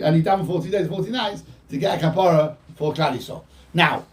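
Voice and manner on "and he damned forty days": usual speaking voice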